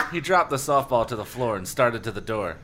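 normal talking voice

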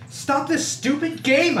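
high, drawn-out desperate yell; frantic high-pitched narrated scream. A narrator's reading. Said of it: "I screamed".

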